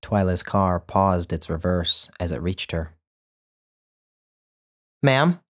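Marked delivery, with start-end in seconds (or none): 5.0-5.4 curt, higher and louder address; brusque start to a widow. A narrator's reading. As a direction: snap out a brisk greeting. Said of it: "she began brusquely".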